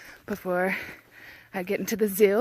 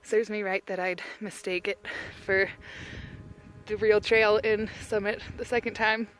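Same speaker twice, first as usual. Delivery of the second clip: normal talking voice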